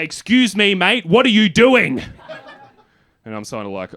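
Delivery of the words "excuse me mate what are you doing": cop's challenge. Retold or imitated. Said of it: "I hear a man's voice say".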